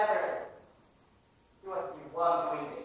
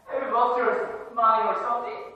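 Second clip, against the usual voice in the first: louder, higher, scornful question; mocking disbelief; 7 semitones higher, 10 dB louder, faster than usual. Student turning on the counselor, loud mocking disbelief. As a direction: throw the question back at him with scorn.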